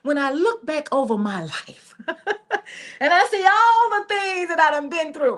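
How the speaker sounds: lightly mimicking a church testimony with a laugh. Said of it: "how y'all do when y'all about to testify at church".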